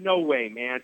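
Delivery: ordinary delivery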